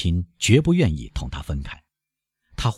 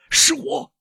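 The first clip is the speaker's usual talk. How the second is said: very high, strained call; tense, high-pitched urgency; uneasy shout. A narrator's reading. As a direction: call out anxiously to be let in. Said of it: "他发出不安的声调".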